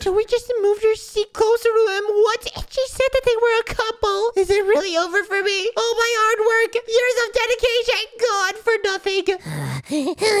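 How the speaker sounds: high, squealing lament; hysterical despair. Acted. Spy sees crush called a couple, hysterical high wailing. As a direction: wail as if all is lost. Heartbroken, crush has a partner.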